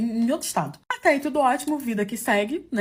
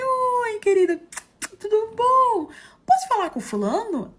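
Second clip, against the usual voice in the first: much higher and louder, sugary politeness; fake sweet voice; 13 semitones higher, 4 dB louder, slower than usual. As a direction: greet her all sweetness, hiding the fury. Fake-sweet greeting to the mistress. (voz falsamente doce para a amante)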